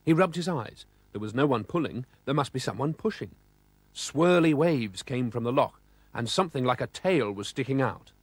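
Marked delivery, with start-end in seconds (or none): none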